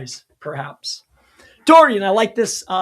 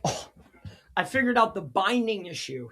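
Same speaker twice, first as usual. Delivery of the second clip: normal talking voice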